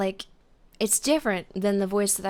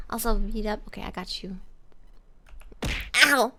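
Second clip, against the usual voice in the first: usual speaking voice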